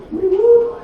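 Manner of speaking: a hammed-up victory cheer; mock cheer. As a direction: whoop it like a sarcastic celebration. Celebrating new name.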